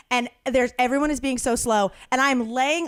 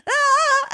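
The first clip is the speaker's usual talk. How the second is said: high yelp of pain; 14 semitones higher, 4 dB louder than usual. Retold or imitated. Refused by the clerk, high pained yelp. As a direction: let out a sharp high yell. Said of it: "I was like".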